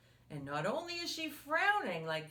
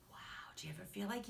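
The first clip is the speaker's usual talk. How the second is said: whisper; 9 dB quieter, much faster than usual. Acted. Asking about yelling, whispered.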